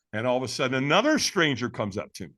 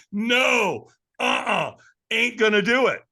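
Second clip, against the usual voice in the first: high, punchy mock refusal; loud, high-pitched digging in of heels; emphatic refusal; 7 semitones higher, 5 dB louder, slower than usual. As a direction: dig in against the crowd. His refusal against the chanting crowd.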